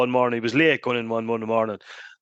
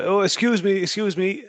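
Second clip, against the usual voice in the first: gentry man voice; 9 semitones higher than usual. Retold or imitated. Posh gentry man quoted.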